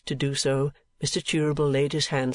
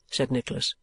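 usual speaking voice

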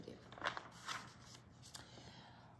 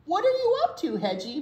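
Mama Pig asking; 8 semitones higher, 7 dB louder, faster than usual. A narrator's reading. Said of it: "Mama Pig asked".

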